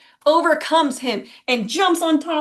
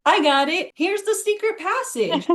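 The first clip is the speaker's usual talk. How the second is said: higher, proud helper voice; boy's voice; 7 semitones higher than usual. Retold or imitated. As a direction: sound smug about the secret. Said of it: "he's like".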